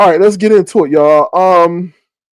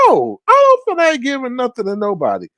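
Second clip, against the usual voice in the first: fast, higher, done-with-it no; fed-up refusal; 10 semitones higher, faster than usual. Retold or imitated. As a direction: rattle it off, fed up. Refusing the beggar.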